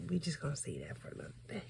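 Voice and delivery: fast hushed secret; whisper. Real, not acted. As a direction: whisper it quickly and coyly. Secret aside to viewers.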